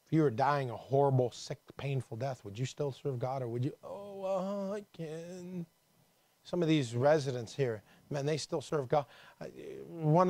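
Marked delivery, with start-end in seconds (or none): 3.8-5.6 high, drawn-out pathetic moan; hammed-up helpless groan; mock feeble whining. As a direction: pretend you are too weak to go on. Mimics giving up under painful sickness.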